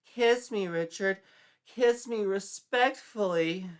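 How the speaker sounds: high, loud, breathless swooning plea. Acted. Sandy begging Richard for a kiss, swooning and loud. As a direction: beg him dramatically to kiss her.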